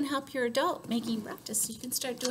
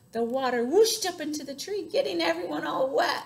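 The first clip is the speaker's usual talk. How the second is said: louder, higher excited narration; animated storytelling; 6 semitones higher, 5 dB louder than usual. A narrator's reading. Storyteller as water soaks the tree, excited and louder. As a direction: sweep the listeners into the big splash.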